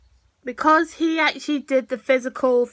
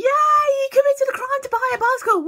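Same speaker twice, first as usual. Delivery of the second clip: mock cheer; 10 semitones higher than usual. Viewer cheering a cartoon crime, mock cheer. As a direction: whoop it up in mock celebration.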